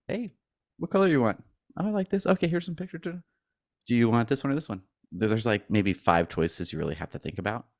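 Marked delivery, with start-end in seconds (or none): 0.1-4.8 high, bright sales pitch voice; upbeat salesperson voice. Retold or imitated. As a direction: play the eager car salesman, pitched up. Imitating a cheery Tesla salesperson.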